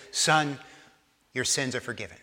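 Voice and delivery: soft, low voice of forgiveness; tender. Retold or imitated. Voicing Jesus forgiving the paralytic.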